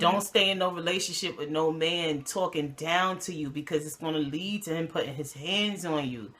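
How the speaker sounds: low, sincere plea; earnest real talk. Real, not acted. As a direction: drop the jokes and speak low and soberly. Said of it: "this is real talk".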